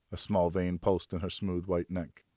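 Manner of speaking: usual speaking voice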